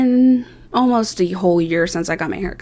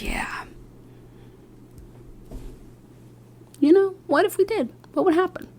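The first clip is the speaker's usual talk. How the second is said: high, soft playful coax; coaxing the hairstylist to shave it, softly and high; coaxing tease; 11 semitones higher, 6 dB quieter than usual. Retold or imitated. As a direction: tempt her, lightly and high. Coaxing stylist into shaving.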